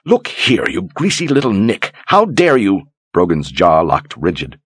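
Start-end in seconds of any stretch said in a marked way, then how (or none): 0.0-2.7 high, outraged noblewoman; indignant outburst. Acted. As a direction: shriek at him in indignation. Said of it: "heat of her indignation".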